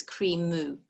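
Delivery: "cream mu" with a soft extra "mu" after cream; cream with final mu. Shown as a demonstration. Teacher correcting student's ice cream, soft final mu. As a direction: add a light "mu" to the end of "cream". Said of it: "Just at, at the very end".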